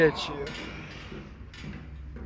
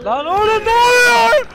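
much higher, louder alarmed cry; shocked outcry; 14 semitones higher, 5 dB louder than usual. Real, not acted. Player shocked by what happens on screen, high outcry.

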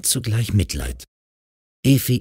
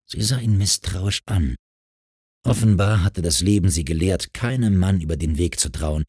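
usual speaking voice

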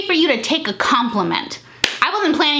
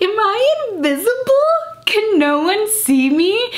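incredulous; 10 semitones higher, slower than usual. Retold or imitated. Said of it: "And I was like".

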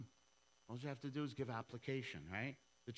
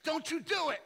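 high loud tempting devil; hammed-up devil warning; 10 dB louder than usual. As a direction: squeal it like a cartoon devil. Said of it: "the devil was like".

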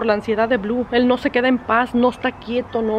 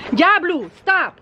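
scolding the dog; 7 semitones higher, much slower than usual. Real, not acted. Dog lunging at her.

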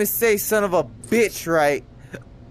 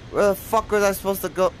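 ordinary talk, nothing marked

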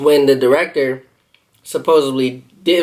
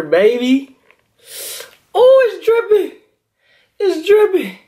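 excited; 14 semitones higher than usual. Real, not acted. Excited over dripping cheese burger.

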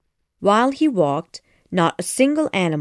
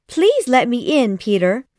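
high, louder little Tink voice; pleading fairy; 5 semitones higher, 4 dB louder than usual. Acted. Tink begs Peter to open the door, high and pleading.

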